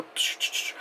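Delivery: hushed hissing shots; short voiceless bursts of a sci-fi gun; imitated weapon noise. Showing the predator's three-dot technology, imitated hissing shots.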